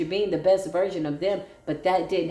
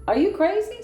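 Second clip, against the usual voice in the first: much higher, louder disbelief; shocked, louder incredulous cry; incredulous outburst; 12 semitones higher, 4 dB louder than usual. Real, not acted. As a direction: throw "Are you crazy?" up high and loud at them.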